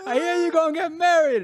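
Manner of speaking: very high imitation of a Caribbean auntie; Saint Vincent accent. Retelling a second mother's call, high Vincentian accent. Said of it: "She's from Saint Vincent".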